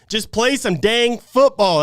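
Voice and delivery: fed up, ordering him to just play; pissed off. Real, not acted. Frustrated fan telling Trent to just play, slow and hammered. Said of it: "I'm pissed, guys".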